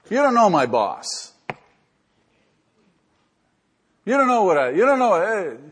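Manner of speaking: hammed-up self-pitying protest; whining excuse maker. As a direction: act out the defensive griper. Imitating an excuse-making congregant.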